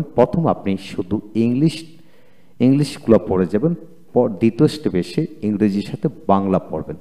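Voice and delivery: ordinary talk, nothing marked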